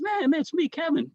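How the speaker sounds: high-pitched retold protest; insistent. Pranked comedian pleads to be recognized, mock insistent.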